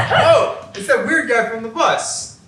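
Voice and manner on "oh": bursts out laughing, high and loud. Acted. Recognizes the fed, laughs.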